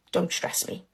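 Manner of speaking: breathy hushed warning from an annoyed official. Retold or imitated. Embassy auntie hushing a pushy applicant, near-whispered. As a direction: drop to a near-whisper, cold and curt. Auntie snaps quietly at the speaker.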